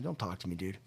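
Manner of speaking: low, very soft inner plea; withdrawn, under the breath. Retold or imitated. Newcomer with head down avoids the stranger, withdrawn. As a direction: keep it down, wanting to be left alone.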